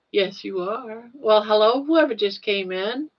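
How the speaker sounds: ordinary delivery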